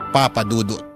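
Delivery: ordinary talk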